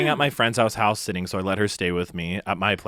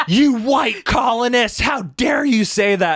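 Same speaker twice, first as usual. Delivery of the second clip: high, loud, slow hammed-up outraged shriek; mock shrieking roommate; 13 semitones higher, 6 dB louder, slower than usual. Imitating the furious roommate he insulted, shrieked high and loud. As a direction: play her as a screeching, offended caricature through a laugh. And she goes.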